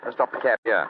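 ordinary delivery

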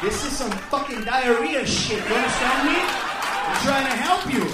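loud, exasperated protest; exasperated insistence. Retold or imitated. Arguing with friend over chili, loud exasperation. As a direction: raise your voice to make him understand.

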